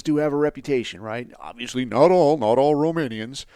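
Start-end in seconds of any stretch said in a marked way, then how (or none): 1.4-3.4 mock disclaimer. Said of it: "you gotta even say that".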